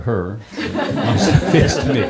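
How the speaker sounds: amused punchline; laughing. Real, not acted. Joking about his wife's belongings to the audience, laughing.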